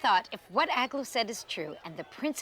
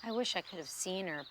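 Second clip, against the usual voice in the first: disappointed; 8 dB quieter than usual. A narrator's reading.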